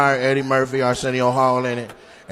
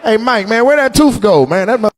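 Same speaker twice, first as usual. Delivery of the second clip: mock buyer at auction; 5 semitones higher, slower than usual. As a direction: play the buyer questioning Mike. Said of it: "I'm like".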